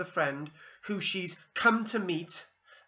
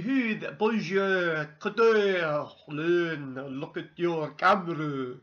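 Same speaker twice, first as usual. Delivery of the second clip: hammed-up, badly faked French accent; 4 dB louder, slower than usual. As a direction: put on a clumsy French accent. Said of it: "in a French accent".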